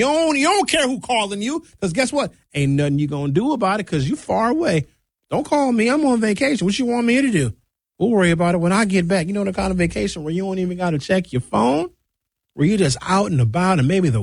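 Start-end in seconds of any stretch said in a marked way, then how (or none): 5.3-9.3 higher, breezy dismissive vacationer; carefree brush-off. Pretend. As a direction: wave off the caller like you're on a beach. Acting out carefree vacationer on the phone.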